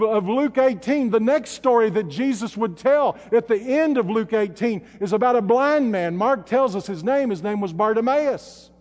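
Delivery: usual speaking voice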